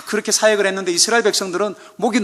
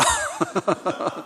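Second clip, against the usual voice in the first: short breathy laugh; 7 dB quieter than usual. Real, not acted. Laughing at own impression.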